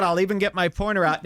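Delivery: usual speaking voice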